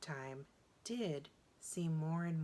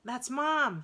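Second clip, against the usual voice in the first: higher, louder excited spotting; little girl spotting mom; 8 semitones higher, 10 dB louder than usual. A narrator's reading. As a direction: point and announce it eagerly.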